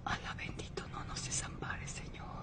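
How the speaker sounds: hushed, breathy plea to God; fervent whispered prayer for her son. Acted. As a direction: whisper it so no one hears.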